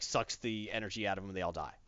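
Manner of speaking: ordinary talk, nothing marked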